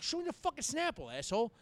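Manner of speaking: high-pitched exasperated comeback, retold; acted indignant protest. Repeats his drunken protest to cops.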